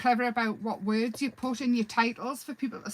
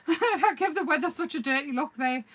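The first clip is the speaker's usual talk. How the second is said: laughing; 6 semitones higher, 4 dB louder than usual. Real, not acted. Laughing at herself.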